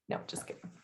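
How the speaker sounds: quick whispered joke; whispering. Real, not acted. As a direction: whisper it teasingly. Teasing aside to the co-host.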